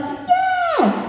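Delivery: yeah! shot up very high, meaning no; high British yeah. Retold or imitated. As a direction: squeal "Yeah!" up high, polite refusal. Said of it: "when our voices go up like that".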